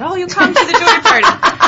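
put-on high-pitched, rough stab at Jamaican accent; attempted Jamaican accent. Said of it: "I can't do the accent".

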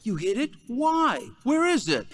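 high, loud, outraged questions; indignant disbelief. Acted. Young inmate to the convict who hid his wrench, outraged. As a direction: demand answers, pitch jumping up in outrage.

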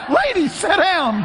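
high, loud, slow telling-off; mock shouted order. Preacher retells ordering lady down, mock shout. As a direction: shout it through laughter. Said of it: "I said".

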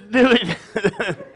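amused chuckle at own joke; real laugh. Real, not acted. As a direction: laugh along with the audience.

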